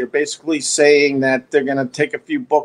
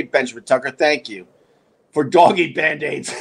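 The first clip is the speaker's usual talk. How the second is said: ordinary talk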